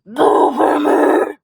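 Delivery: very loud, slow drawn-out title; booming announcer. Acted. Announcing the show name in a booming ring announcer style.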